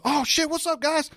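acted excited greeting. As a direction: imitate someone delighted to spot his pals. Ray acted calling back to them.

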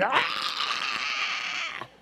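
high, hammed-up screams of rage; mock ranting shout. Preacher mimicking an angry churchgoer, high hammed-up shrieking. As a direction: shriek like a red-faced ranter.

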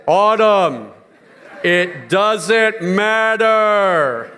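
slow, booming, echoing voice of God; mock divine voice, drawn out very slow. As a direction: speak as the Almighty from above. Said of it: "I put on my God voice for her".

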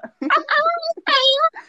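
high-pitched laughing burst. Real, not acted. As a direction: crack up helplessly. Laughs at story.

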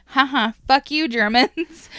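playful defiant nyah-nyah; gloating taunt. Acted. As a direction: crow it triumphantly at the enemy. Voices the defiant taunt at Germans.

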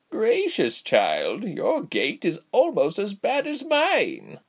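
put-on high, swooping old lady voice; slow, drawn-out granny exclamation; exclaiming old woman. A narrator's reading. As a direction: cluck over it like an amused granny. Old woman exclaims at Ilse's gait.

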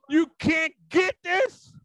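high, slow, indignant plea; acted angry exasperation. Preacher voicing Jesus at unbelief, acted exasperation. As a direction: stretch it out in pained disbelief. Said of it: "and you so angry, you start crying".

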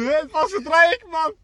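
high, loud, drawn-out reenacted protest; confused shout back. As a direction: shout back in baffled disbelief. Retells own confused shout back.

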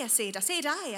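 loud, high bellow. A narrator's reading. Angus bellowing to the girl over the carousel music. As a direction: shout it so she hears over the music. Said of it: "Angus bellowed".

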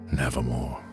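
ordinary talk

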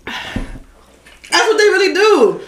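laughing. Real, not acted. Laughing at ASMR.

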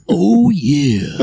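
a light mock Kool-Aid Man shout; light Kool-Aid Man impression. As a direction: bellow it like bursting through a wall. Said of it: "is the Kool-Aid guy in here?".

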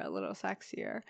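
low husky sultry voice; husky sick voice. Pretend. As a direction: drop into a low, husky purr. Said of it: "I kinda dig my sick voice".

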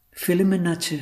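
high, gentle questioning voice; Sulekha. Acted. Actress asking her tutor about film, high character voice. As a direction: ask in a small high voice.

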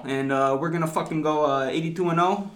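hammed-up Philly accent, sports fan bragging. Podcaster hypes the Sixers on request, mock Philly accent. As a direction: put on your best brash Philly accent. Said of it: "Straight from the streets of Philly".

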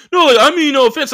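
high, loud mock of a defensive comic; imitated backpedalling. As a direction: imitate him hurriedly excusing himself. Voices other comic backpedalling.

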